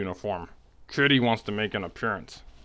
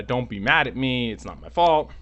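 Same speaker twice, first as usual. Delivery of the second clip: higher, louder hammed-up excuse; mock pleading; 4 semitones higher, 6 dB louder than usual. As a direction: plead your innocence, climbing in pitch. Rehearsed excuse to angry customer.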